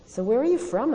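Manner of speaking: yell. A narrator's reading. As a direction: call out to someone walking away. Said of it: "I yelled".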